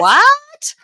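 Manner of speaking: high, loud, incredulous what; shocked. Real, not acted. Podcaster hears herself praised in a review, shocked. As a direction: cry out in disbelief.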